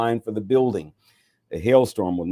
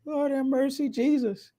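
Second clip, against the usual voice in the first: much higher, squeaky Lord have mercy; squealed exclamation. Real, not acted. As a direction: yelp it in falsetto, amused and amazed. Laughing at the passage.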